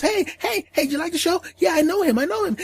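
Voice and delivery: put-on very high, eager kid chatter; little kid voice. The boy greets everyone while offering food, very high and eager. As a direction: bounce it up high like an excited kid.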